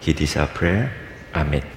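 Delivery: prayer. Acted. Leads congregation in prayer.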